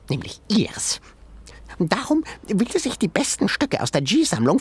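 high, indignant little animal voice; tiny creature voice. A narrator's reading. Sengi explaining the museum owner to Ben, high creature voice. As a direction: rant like an outraged critter.

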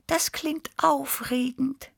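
very high, slow dreamy cat voice; high dreamy kitten. A narrator's reading. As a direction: marvel slowly in a tiny high voice. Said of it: "wiederholte Tibi verträumt".

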